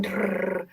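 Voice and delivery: rolled "drrrr" like a flipping Rolodex; whirring brain noise. Retold or imitated. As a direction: imitate a brain riffling through names, low and soft. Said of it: "my brain is going".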